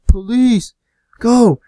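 high, loud warning shout; retold yell. Friend warning the group about a cop car, retold yell. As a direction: yell it like an urgent alarm. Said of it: "somebody yells".

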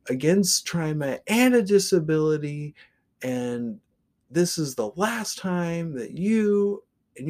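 high, loud furious rant about discrimination; ranting tirade. Retold or imitated. Shoeless man ranting at the driver, high and loud.

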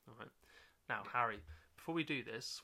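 ordinary delivery

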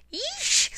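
very high screech; monster screech. Acted. Penguins haunting hunters, shrill monster screech. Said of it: "screeched the monster".

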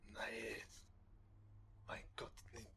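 whispered aside; whispering. Real, not acted. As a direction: breathe it out unvoiced.

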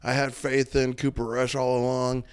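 gushing like a fickle fan; mock bandwagon fan. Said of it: "they're like".

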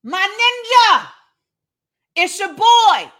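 high, drawn-out call; shouted. Acted. Jesus calling his friend out of the tomb, high shout. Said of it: "Then Jesus shouted".

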